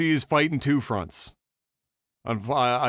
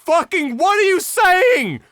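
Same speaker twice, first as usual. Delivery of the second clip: high, loud exasperated rant; exasperated boyfriend; 9 semitones higher, 4 dB louder than usual. Retold or imitated. Boyfriend yelling at his girlfriend, high exasperated rant. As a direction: yell it at her in disbelief. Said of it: "And you're like".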